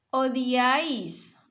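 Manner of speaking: Odiáis said slowly and loud, o-diáis; slow example word. Shown as a demonstration. Teacher pronouncing odiáis slowly for pupils. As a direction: stretch odiáis out for the class. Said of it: "Se separa en dos sílabas".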